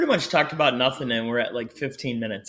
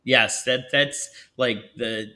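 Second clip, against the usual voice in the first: normal talking voice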